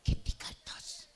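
breathy secret whisper, slow; whispered girlfriend voice. Acted. Girlfriend whispering to her lover at night, slow whisper. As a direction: whisper like a girlfriend hiding under the sheet.

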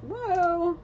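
sudden high whoa, thrown out; startled by what she sees; high burst of surprise; surprised whoa. Real, not acted. Sudden exclamation mid reading.

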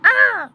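high loud bird screech; eagle screech. A narrator's reading. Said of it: "screeches a message".

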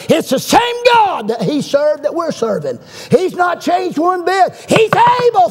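soaring, high-pitched pulpit cry; preaching shout. Real, not acted. Preacher declaring God unchanged to the congregation, shouting. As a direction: build to a piercing peak. Sermon climax about God's power.

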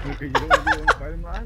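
high, loud laughing taunt. Real, not acted. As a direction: tease him through a laugh.

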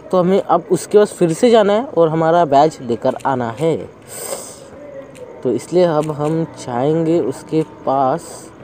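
normal talking voice

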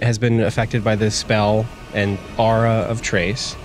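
usual speaking voice

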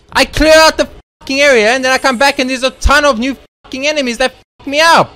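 loud, high angry shouting; real gamer rage. Real, not acted. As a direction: yell in outrage at the game. Gamer raging at spawning enemies.

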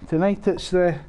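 ordinary delivery

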